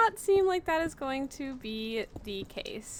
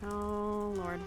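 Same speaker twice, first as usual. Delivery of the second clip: quiet panic; 2 semitones lower, 12 dB quieter than usual. Real, not acted. Gamer under threat talking to self, quietly panicking. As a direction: say it small and soft under pressure. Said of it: "I'm panicking".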